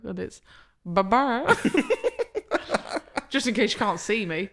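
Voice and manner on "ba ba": rising two-beat bird noise; flamingo walking sound. Shown as a demonstration. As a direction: imitate a flamingo's steps. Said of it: "to walk like a flamingo".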